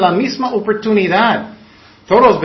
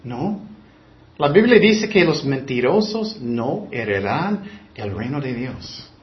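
ordinary talk

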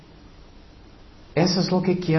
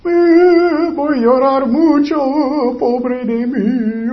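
high, hammed-up whimper of self-pity; mock crying; 8 semitones higher, 3 dB louder, much slower than usual. Preacher mocking false repentance with a fake whimper. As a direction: whine it like a fake crybaby, drawn out slow. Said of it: "Arrepentimiento que es falso es que".

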